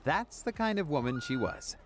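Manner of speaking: ordinary delivery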